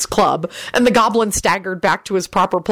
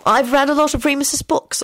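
high, pleased-with-herself boast; high-pitched self-satisfaction; smug; 8 semitones higher than usual. A narrator's reading. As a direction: say it smugly in a higher voice. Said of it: "Elizabeth told the group smugly".

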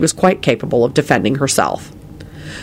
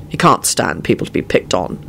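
low, thoughtful voice; musing; 8 semitones lower than usual. A narrator's reading. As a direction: muse it in a lower register. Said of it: "Quentin mused".

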